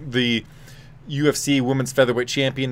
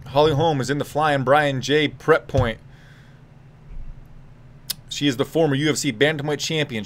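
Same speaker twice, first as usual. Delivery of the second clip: normal talking voice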